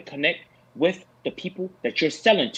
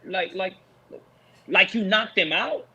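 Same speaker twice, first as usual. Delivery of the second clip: ordinary talk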